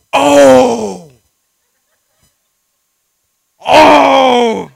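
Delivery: high, loud strangled cry; hammed-up panicked gasp; terrified gasp. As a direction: choke out a frightened cry on the drop. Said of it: "didn't even have time to scream".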